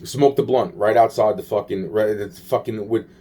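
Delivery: usual speaking voice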